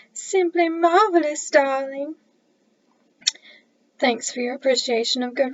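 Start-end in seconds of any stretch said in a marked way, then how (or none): 0.1-2.2 slow, higher, louder Southern drawl. Pretend. Narrator voicing the reader's praise of her story in a slow, high Southern drawl. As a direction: stretch it out like a Southern belle. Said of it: "with a southern drawl".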